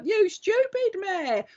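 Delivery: high, slow mock telling-off; teasing high mock yell; mock shout. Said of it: "makes me want to shout at you".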